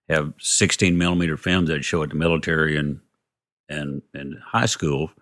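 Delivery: usual speaking voice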